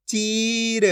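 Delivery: चीर blended with a long held vowel. Shown as a demonstration. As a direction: stretch the ई in चीर. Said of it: "खींच के पढ़ा जाता है". (चीर की ई खींचकर)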